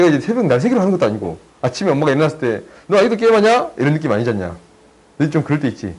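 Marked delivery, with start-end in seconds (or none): 2.9-3.7 high, loud mock mom nag rising at the end; nagging mom. Tutor imitating a mom catching her kid gaming, high nagging voice. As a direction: play the exasperated mother catching him.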